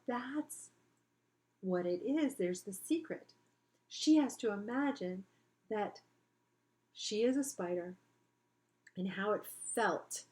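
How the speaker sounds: plain reading voice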